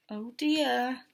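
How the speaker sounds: plain reading voice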